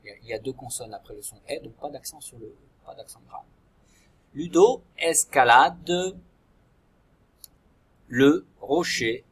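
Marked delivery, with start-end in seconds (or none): 4.3-9.2 louder and slow, each word given space to write; slow dictation. Shown as a demonstration. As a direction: read the model sentence out for the children to copy. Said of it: "je vous donne un modèle". (dictée lente et forte, mot à mot)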